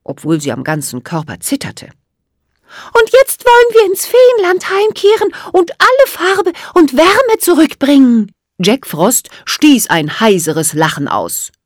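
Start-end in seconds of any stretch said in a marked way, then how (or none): 3.0-8.3 defiant little fairy, high and loud; high-pitched, determined fairy; Emma Erdbeerrot. A narrator's reading. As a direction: announce it boldly in a tiny high voice. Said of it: "erklärte Emma Erdbeerrot und reckte das Kinn vor".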